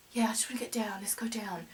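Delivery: small, whispered plea; retold near tears. Shaking walker telling Ian she wants down, near-tearful whisper. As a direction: whisper it weakly, voice almost gone. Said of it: "you're almost in tears".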